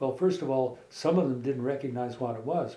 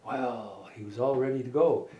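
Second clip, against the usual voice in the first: ordinary delivery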